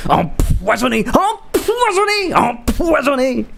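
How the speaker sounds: very high, slow, wailing theatrical cry; melodramatic soap actor. Acted. Mimicking a French soap melodrama, high theatrical wail. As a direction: wail it like a tragic soap heroine. Dramatic soap quote, voiced.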